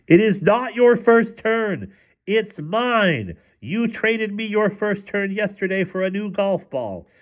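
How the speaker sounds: very high, indignant yell. A narrator's reading. Wife pounding on the bathroom door, yelling indignantly. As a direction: protest in a high, drawn-out screech.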